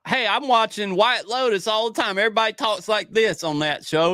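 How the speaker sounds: a hammed-up North Carolina accent. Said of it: "it's supposed to be North Carolina accent".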